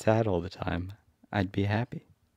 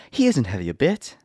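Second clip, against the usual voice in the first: eager; 5 semitones higher, 5 dB louder than usual. A narrator's reading. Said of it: "said Dorothy eagerly".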